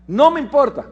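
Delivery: high, loud curt refusal; defiant retort. Retold or imitated. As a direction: shut him down flatly. Paciente terco rechaza estadística. (réplica desafiante, alta y fuerte)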